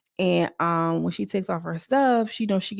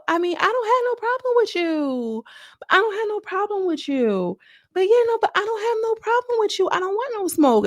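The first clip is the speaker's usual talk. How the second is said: much higher, light and timid, imitating Anna; small, gentle voice that wants no trouble; reluctant fighter pleading to avoid conflict, high and soft; 13 semitones higher than usual. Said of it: "She's speaking so soft and so light".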